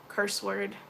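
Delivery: usual speaking voice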